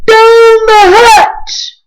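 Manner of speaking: high, loud, very slow crew shout; a rowdy crowd echoing the toast. A narrator's reading. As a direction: bellow it back at the captain. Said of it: "we all shouted".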